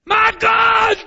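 high, loud shout of My God; anguished cry from the cross. Acted. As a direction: cry out to heaven. Repeating Jesus' cry.

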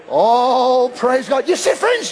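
usual speaking voice

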